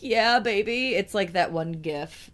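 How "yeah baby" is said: mild mock cheer, higher pitched; half-hearted imitation of an excited yell; retold excited scream. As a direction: retell your own delighted cry, voice lifted. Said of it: "screamed up and was like".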